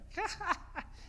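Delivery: high-pitched gloating cackle; gleeful laugh. Acted. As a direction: laugh triumphantly at your rival. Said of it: "너무 기쁜 거예요". (신나서 약 올리는 웃음)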